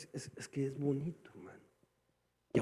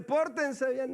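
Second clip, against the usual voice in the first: proclaiming God's word; 9 semitones higher, 4 dB louder, much slower than usual. Acted. God voiced against the world.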